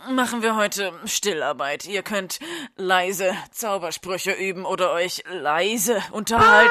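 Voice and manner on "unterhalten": high, loud, over-the-top announcer voice; loud eccentric headmaster. Acted. Headmaster barging into class to announce crochet club, high and loud. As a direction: burst in like a hyper headmaster. Dumbledore bursts in loudly.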